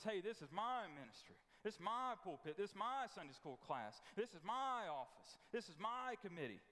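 possessive MY leaned on each time; claiming every thing as my own; stress on my. Retold or imitated. Church member insisting on MY ministry with stress.

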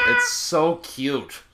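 high laughing, then slow; laughing squeal. Real, not acted. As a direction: laugh it out in delight. Laughing at the lizard's diet.

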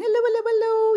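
high, rapid-fire hellos; as fast as possible. Shown as a demonstration. Greeting babies as fast as we can, rapid high voice. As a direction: rattle them off without a gap. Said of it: "as fast as we can".

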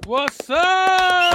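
shouted hello back; loud greeting. Real, not acted. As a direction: yell it back happily.

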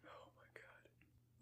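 hushed unvoiced aside; whispering. Real, not acted. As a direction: whisper it so he can't hear.

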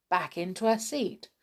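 plain reading voice

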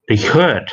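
"record" said ri-CORD, stressed at the back; stress on second syllable. Shown as a demonstration. Presenter repeats the verb reading, second-syllable stress. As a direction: hit the last syllable of "record". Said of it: "เน้นคำหลังเป็น".

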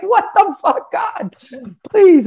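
very high-pitched, laughing plea; mock panic. Newcomer begging God in the elevator, mock panic. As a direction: squeal a hammed-up panicked prayer. Acting own terrified inner plea.

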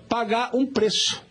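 usual speaking voice

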